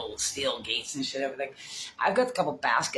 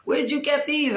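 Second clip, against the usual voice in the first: put-on higher, louder kid voice asking; imitating kids; 12 semitones higher, 6 dB louder than usual. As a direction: mimic the curious kids, pitched up. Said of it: "The fucking kids were like".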